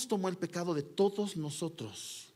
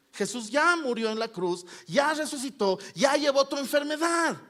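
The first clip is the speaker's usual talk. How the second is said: high, loud preacher's build; fervent sermon climax; preaching at full force; 8 semitones higher, 4 dB louder than usual. Acted. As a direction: drive the promise home from the pulpit.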